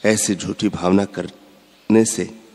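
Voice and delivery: usual speaking voice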